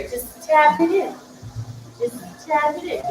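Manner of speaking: stretched-out mock movie line; movie quote. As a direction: coax it in slowly, quoting a film. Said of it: "I haven't seen the movie".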